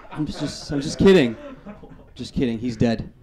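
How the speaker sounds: ordinary delivery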